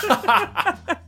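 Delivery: laughing curse. Retold or imitated. Friend's reaction quoted, laughing.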